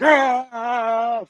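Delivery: sharp high grunt of effort; a quick strained battle cry; imitating an all-out fight yell; mock fight grunt. Demo of going full bore.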